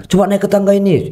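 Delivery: ajakan riang, nada jauh lebih tinggi; mengajak main. Retold or imitated. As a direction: membujuk anak dengan ceria. Ayah mengajak anak naik. (ayah membujuk anak bermain, riang dan bernada tinggi)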